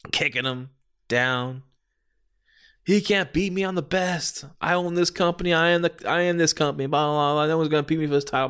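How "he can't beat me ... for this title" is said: high-pitched heel bragging; acted wrestler's trash talk; boastful rant. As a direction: rattle off a cocky villain's boast. Acting out heel's boasting rant.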